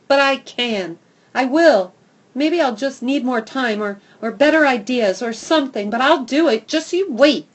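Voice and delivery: loud, high defiant promise; defiant vow. Acted. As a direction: swear you'll do it.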